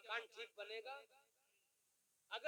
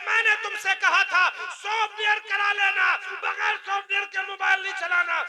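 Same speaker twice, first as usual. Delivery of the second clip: high, loud acted telling-off; retold scolding at full volume; scolding repairman; 8 semitones higher, 21 dB louder than usual. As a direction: play a mechanic shouting at his customer. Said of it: "चलाने वाले को डांटते".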